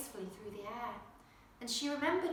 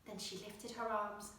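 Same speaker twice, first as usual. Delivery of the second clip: usual speaking voice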